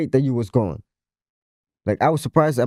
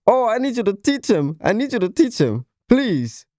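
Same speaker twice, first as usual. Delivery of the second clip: higher-pitched mock begging; retold pleading; 7 semitones higher than usual. Retelling the uncle's phone call, imitated pleading. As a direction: imitate someone desperately asking a favour.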